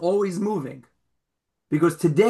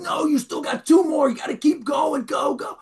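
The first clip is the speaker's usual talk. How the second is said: coach shouting; 10 semitones higher than usual. Retold or imitated. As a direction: drive him on with a yell. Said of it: "He goes".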